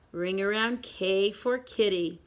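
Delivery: usual speaking voice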